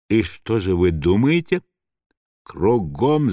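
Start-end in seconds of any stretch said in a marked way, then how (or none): none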